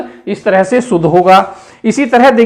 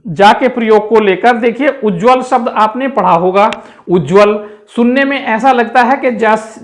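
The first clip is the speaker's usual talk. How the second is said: usual speaking voice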